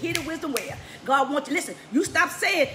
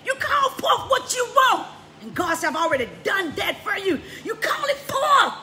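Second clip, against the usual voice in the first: preaching at full force; 7 semitones higher, 6 dB louder than usual. Real, not acted.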